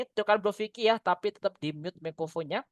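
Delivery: normal talking voice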